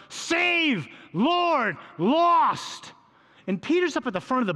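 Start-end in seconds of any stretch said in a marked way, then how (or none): 0.1-2.9 much higher and louder, yelling over a storm; screamed plea. Retold or imitated. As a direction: scream each word over the wind. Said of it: "You have to scream".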